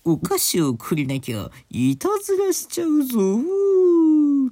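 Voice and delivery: hammed-up kabuki cry, soaring very high, loud and long; exaggerated kabuki actor. Shown as a demonstration. Host redoing the Halloween threat for laughs, full kabuki howl. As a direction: overdo the kabuki, holding the last vowel high. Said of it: "歌舞伎かっていうね".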